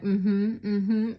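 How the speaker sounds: loud, curt mm-hmms, brushing him off; very dismissive. Retold or imitated. Brushing off a man at the beach, loud and dismissive. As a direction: grunt it out to end the talk. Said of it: "very dismissive".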